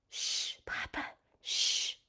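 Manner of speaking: urgent whispered shh; hushing whisper. Acted. Sister hushing Papa in Rocky Gorge, whispering. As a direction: hush him before the rocks fall.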